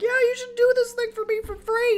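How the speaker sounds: very high, squeaky put-on voice; mock the freeloaders in a squeaky falsetto; cartoonish entitled client voice. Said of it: "people will come in here and be like".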